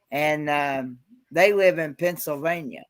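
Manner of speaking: ordinary delivery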